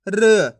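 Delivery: "र" sounded alone with a short neutral vowel; teacher sounding out "र"; isolated letter sound. Shown as a demonstration. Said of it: "एक एक अक्षर का उच्चारण करके".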